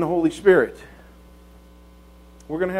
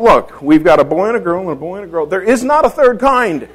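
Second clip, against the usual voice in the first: exasperated announcement; 3 semitones higher, 7 dB louder than usual. Retold or imitated. Father announces to wife after four children, mock exasperation. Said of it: "I announced to Joan".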